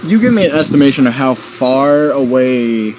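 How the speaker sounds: ordinary delivery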